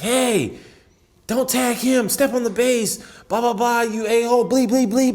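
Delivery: high-pitched imitation of a cursing heckler; mocking, high bench-jockey voice. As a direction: call out taunts high across the field. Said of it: "I hear somebody from their bench".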